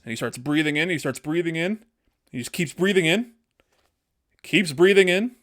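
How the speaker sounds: building suspense. Acted. Storyteller stretching out Kirby's big inhale, building suspense. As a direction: pitch climbing with each repeat, loudest on the last.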